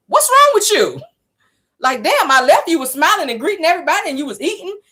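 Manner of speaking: high incredulous man's voice; confused husband. Retold or imitated. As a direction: blurt it like a shocked husband. Quoting Lewis confused.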